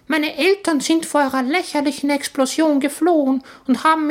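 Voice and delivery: very high, squeaky smug tiny voice; clever baby voice. Acted. Baby in the suitcase boasts to the boys, very high. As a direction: brag like a know-it-all infant.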